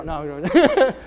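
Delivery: usual speaking voice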